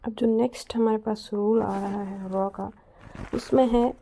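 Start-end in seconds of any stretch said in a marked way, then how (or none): none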